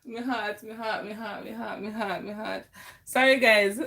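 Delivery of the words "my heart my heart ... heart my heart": much softer, lower, hand-on-chest murmur; soft repeated my heart, winded; breathless murmur. Real, not acted. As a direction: murmur it to calm a racing heart. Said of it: "breathing out to calm myself".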